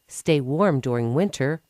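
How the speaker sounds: ordinary delivery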